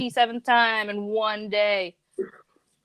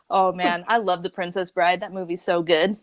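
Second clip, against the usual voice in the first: usual speaking voice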